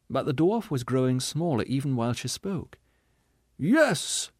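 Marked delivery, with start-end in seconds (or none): 3.6-4.3 high, slow, theatrical self-pitying voice; a wounded ham actor milking every line; tragedian. Acted. Tragedian replies.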